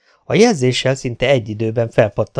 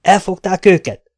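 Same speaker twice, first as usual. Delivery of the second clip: eager, higher and louder question; 8 semitones higher, 5 dB louder than usual. A narrator's reading. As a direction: lit up with hope. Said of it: "csillant fel Vergeron szeme". (izgatott, reménykedő kérdés)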